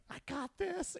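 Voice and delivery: very high-pitched reassurance; mock confidence. Untrained trainee bluffing to the EMT, mock confidence.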